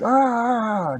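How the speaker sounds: high, very loud storybook scream; loud acted yell; mock scream of fright. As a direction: shriek as if jump-scared. Principal screams at jump scare.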